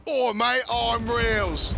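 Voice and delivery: hammed-up English bloke introducing himself; English accent impression. Caster impersonating Reels in a mock English accent. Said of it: "[name]'s impressions of English people".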